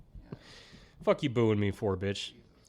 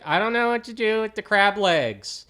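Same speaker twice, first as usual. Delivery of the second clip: put-on whiny kid; 10 semitones higher, 5 dB louder than usual. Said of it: "my brother's like".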